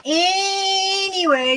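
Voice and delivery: high, slow, sing-song anyway; drawn-out anyway. Real, not acted. Streamer snapping back to chat, a high drawn-out sing-song. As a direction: stretch it out high to change the subject. Loudly switching topic.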